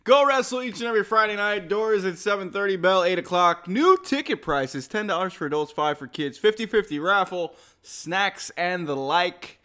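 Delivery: upbeat announcer plug for the show; promo read. Acted. Co-host plugging weekly wrestling show details, announcer promo read. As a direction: sell the Friday show like a ring announcer.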